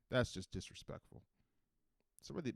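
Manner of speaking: ordinary talk, nothing marked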